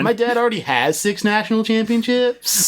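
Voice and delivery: put-on high bratty kid boast; kid bragging. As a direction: brag like a schoolkid.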